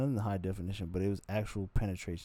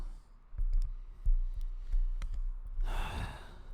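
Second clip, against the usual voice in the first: long heavy sigh; 4 dB louder than usual. Real, not acted.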